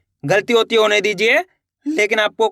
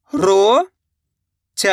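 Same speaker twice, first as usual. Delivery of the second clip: "रोचक" split as "रो ... च ... क" with long pauses; very slowly sound out each part of "रोचक"; letter by letter; much slower than usual. Shown as a demonstration. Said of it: "एक एक अक्षर का उच्चारण". ("रोचक" का हर अक्षर अलग: रो ... च ... क)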